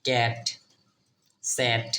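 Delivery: ক্যাট, স্যাট with an open ae vowel; example pronunciation. Shown as a demonstration. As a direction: say ক্যাট, স্যাট separately and evenly. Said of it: "এরকম". (ক্যাট, স্যাট আলাদা করে স্পষ্ট উচ্চারণ)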